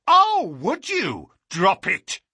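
high, loud sudden command; sharp shout. Acted. Holmes shouts at the young man cocking a revolver. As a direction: bark it to stop the gun.

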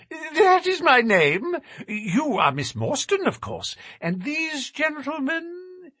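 high, thin nervous little man. A narrator's reading. Narrator voices Thaddeus.

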